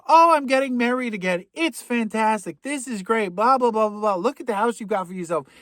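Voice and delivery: mock mother breezing through the door; gushing mother. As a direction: gush at her son like a pushy mom. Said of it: "and it's Alfred's mother".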